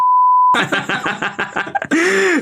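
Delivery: cracking up at her own crude line; high-pitched laugh. Real, not acted. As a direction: burst into a high cackle. Reacting to crude joke.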